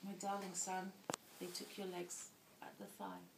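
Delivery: soft, halting retold grief; understated sorrow. Mother telling her darling son of his injury, quietly sorrowful. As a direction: break it to them quietly, with long pauses.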